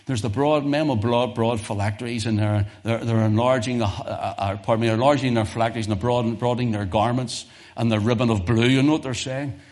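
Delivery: ordinary talk, nothing marked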